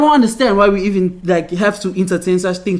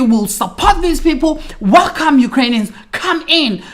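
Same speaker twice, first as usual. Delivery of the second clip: raised, emphatic welcoming call; mock eager welcome; 7 semitones higher than usual. Host nation calling Ukrainians in, mock eager welcome. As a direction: play an open-armed public welcome, pitched up.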